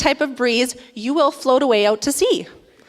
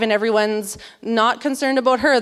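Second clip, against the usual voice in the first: ordinary talk, nothing marked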